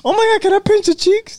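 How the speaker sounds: put-on high squealing coo over a cute baby; squealing baby talk aunt. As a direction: gush like an aunt pinching cheeks. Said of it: "how the fuck she said that".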